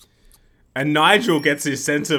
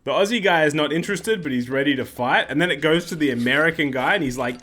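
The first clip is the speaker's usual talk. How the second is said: ordinary talk, nothing marked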